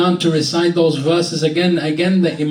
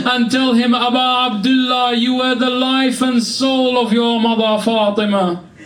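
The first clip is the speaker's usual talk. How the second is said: crying out; 8 semitones higher than usual. Acted. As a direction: cry it out to the mourners. Climax of grieving sermon.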